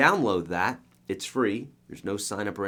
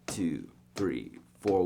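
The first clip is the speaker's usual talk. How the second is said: whispered count; 5 semitones lower, 4 dB quieter, much slower than usual. Shown as a demonstration. Teacher shows weak beats, whispered two three four. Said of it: "We usually emphasize the one a little louder".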